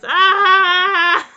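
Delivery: high loud scream melting into laughter; shrieking laughter. Real, not acted. Player thrilled by the kiss, screaming laughter. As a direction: scream then laugh with glee.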